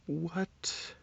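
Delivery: ordinary delivery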